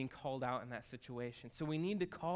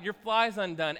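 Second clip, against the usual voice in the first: loud, higher "Your fly's undone"; loud true-friend version of "Your fly's undone"; passionate friend; 4 semitones higher, 9 dB louder than usual. Retold or imitated. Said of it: "a true friend is like".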